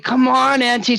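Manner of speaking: high, whiny plea; impatient kid. Retold or imitated. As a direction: hurry her up, pleading. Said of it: "and you'd be like".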